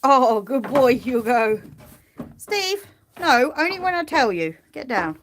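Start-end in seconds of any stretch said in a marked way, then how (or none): 0.0-1.6 louder fond praise. Real, not acted. Praising the sheep. 2.4-4.6 high firm command; firm telling-off. Real, not acted. Telling off the sheep.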